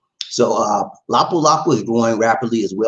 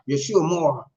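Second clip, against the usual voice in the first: usual speaking voice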